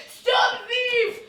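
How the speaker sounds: high, loud shout of Stop thief; loud hammed-up chase cry; shouting after a thief. Shown as a demonstration. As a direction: yell it after a runaway thief. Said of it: "a man was shouting".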